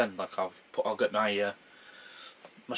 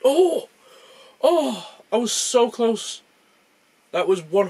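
excited; 11 semitones higher, 9 dB louder, slower than usual. Real, not acted. Near-perfect guess revealed.

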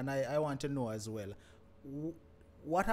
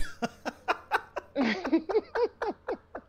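real laugh; 12 semitones higher, 5 dB louder than usual. Real, not acted. Host laughs at the impersonation.

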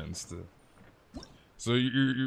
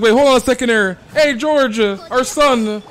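high, loud alarmed mother; hammed-up shocked mother calling George; shocked mom; 12 semitones higher, 12 dB louder than usual. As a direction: shout to the husband in mock panic. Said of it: "And Mom's like".

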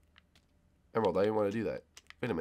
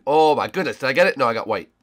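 ordinary talk

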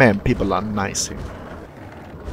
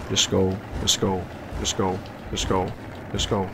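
low-key, soft repeated urging; urgent muttering; 3 semitones lower, 9 dB quieter than usual. Real, not acted. Player urging himself on against the clock, urgent muttering. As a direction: mutter it quietly, over and over.

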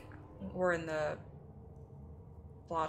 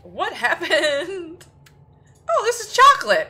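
much higher, louder startled yelps; high excited shock; 14 semitones higher, 15 dB louder, slower than usual. Real, not acted. Streamer reacting to what the game shows, high and loud. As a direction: squeal it out in surprise.

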